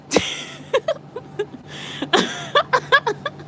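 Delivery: long real laugh, pitched very high; cracking up high at the punchline; a big high laugh at the end of the story. Real, not acted. Laughing after finishing the story.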